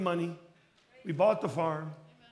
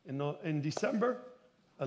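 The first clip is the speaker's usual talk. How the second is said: ordinary talk, nothing marked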